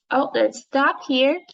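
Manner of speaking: ordinary talk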